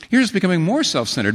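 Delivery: ordinary delivery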